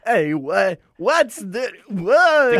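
lightly voiced Arnold, ending on a loud what; Arnold impression. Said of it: "The classic Arnold".

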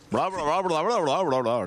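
sing-song mock Swedish chef gibberish; fake Swedish. Shown as a demonstration. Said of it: "He talks like fake Swedish".

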